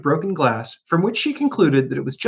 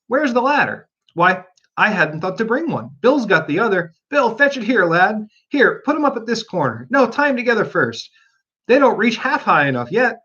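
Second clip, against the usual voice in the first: crowd of animals; 7 semitones higher than usual. Acted. Animals working with ladder.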